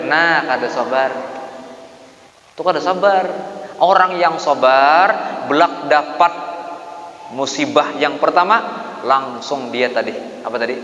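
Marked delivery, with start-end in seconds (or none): none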